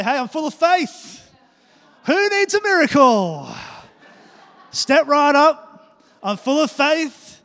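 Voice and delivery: loud and high, slowly beaming with confidence; exaggerated faith-filled greeting. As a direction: hype it up like a show barker. Preacher acts out faith-filled churchgoer.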